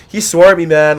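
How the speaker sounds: a lightly hammed frat boy tells on a guy to security; dumb jock frat boy. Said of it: "I was just acting like some dumb jock frat boy".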